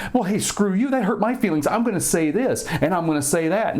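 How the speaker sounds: high, pushy mock retort; mock angry comeback. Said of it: "And then this guy goes".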